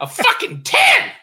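laughing high Billy Bob yell. Retold or imitated. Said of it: "Billy Bob from Varsity Blues".

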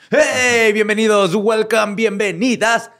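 loud, high, upbeat host welcome; excited show greeting. Acted. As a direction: throw open the show with a big cheer. Podcast host opening the show.

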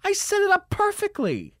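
very high, screechy mock scolding; exasperated woman. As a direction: sputter in hammed-up falsetto outrage. Lady scolds him, retold.

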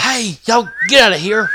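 yelling at bear. Retold or imitated. Hunter reenacting how he yelled at the approaching bear. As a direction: drive the bear off with a yell. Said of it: "This is how he's screaming".

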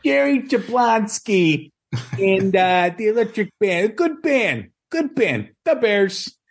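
high, slow mock Chicago accent reading the names; hammed-up Chicago accent, drawn out like a local; playful Chicago accent aimed at the guest's picks. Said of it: "Sounds like he's from Chicago".

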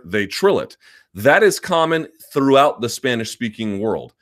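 ordinary talk

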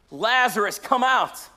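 high, loud shout to raise the dead; commanding call. Retold or imitated. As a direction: call him out of the tomb with full force. Jesus calls the dead man out.

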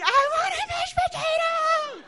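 put-on drawn-out, high-pitched child tantrum scream. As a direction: scream it like a little monster. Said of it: "I was just screaming".

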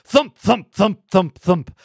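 punchy, evenly spaced thumps; loud rhythmic thump noises; imitated thumping. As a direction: voice the thud of falling bodies. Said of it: "you hear thump".

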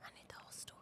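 hushed, eager whisper. Real, not acted. Co-host begs for the whole story in an excited whisper. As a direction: lean in and whisper your questions.

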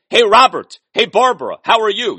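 mock calling parents Robert and Barbara; mock greeting to parents by first name. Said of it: "you don't say".

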